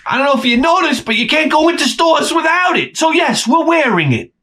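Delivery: high and fast, fed-up; high, quick, exasperated protest; exasperated high rant. Acted. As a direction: pitch it way up like you're fed up.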